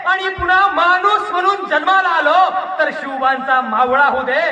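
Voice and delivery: high, full-voiced declamatory shout; fervent climax. Orator begging to be Shivba's soldier, high fervent shout. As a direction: cry the wish out at the peak of the plea.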